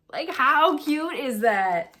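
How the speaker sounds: high, loud, stretched-out squeal of delight; excited gushing. Real, not acted. Knitter showing viewers a tiny stitch marker, excited gushing.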